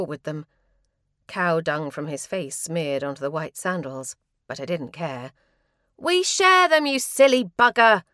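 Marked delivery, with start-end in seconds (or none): 6.0-8.0 high, loud furious scream. Acted. Lizzie screaming at her brother over the sandals, furious. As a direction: scream it at your brother, full force. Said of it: "Lizzie screamed".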